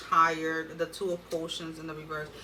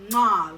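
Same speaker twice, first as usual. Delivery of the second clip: loud smacking kiss; blown kiss; 9 dB louder than usual. Real, not acted. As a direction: send a kiss to the camera. Blowing kiss goodbye to viewers.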